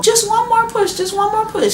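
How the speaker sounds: high, loud sing-song coaching voice; mimicking midwife. Midwife coaching the mother to push, high and loud. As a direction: chant it high like an eager midwife.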